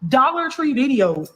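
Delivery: "Dollar" hit hard and slow; slow, stressed DOLLAR. Real, not acted. To viewers repeating her point, slow and disbelieving. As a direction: drag out Dollar Tree in disbelief. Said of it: "y'all literally see".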